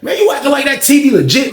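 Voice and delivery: high, needling disbelief; super petty. Retold or imitated. Teller needles Fonz over the T V, super petty. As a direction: re-enact a petty high-pitched dig. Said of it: "I got super petty with his ass".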